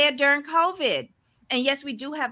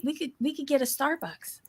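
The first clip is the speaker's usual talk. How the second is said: ordinary delivery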